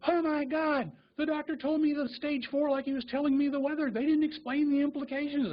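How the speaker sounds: high-pitched mock astonishment of a patient; shocked realization. Patient reacts to learning what stage four means, shocked. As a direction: imitate someone who has just grasped terrible news, voice held high. Patient suddenly grasps how bad it is.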